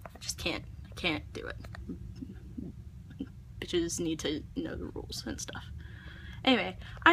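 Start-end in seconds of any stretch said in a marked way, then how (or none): 0.0-5.6 lower, softer seething whisper; frustrated whisper. Real, not acted. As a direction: mutter it under your breath. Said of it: "gets me super ultra worked up".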